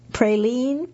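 "praline" with a first syllable like "pray"; Georgia pronunciation. Shown as a demonstration. Reader goes through flashcard words, "praline" said as PRAY-leen. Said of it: "where they call the sugary candy a praline".

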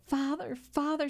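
high-pitched, urgent daughter's appeal; distressed cry. A narrator's reading. Daughter calls to her stricken father, distressed cry. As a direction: narrator acting a girl crying out. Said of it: "she cried".

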